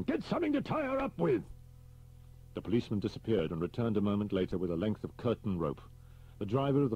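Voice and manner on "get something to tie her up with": much higher urgent shout. Acted. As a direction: bark the order across the room. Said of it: "Homan shouted at him".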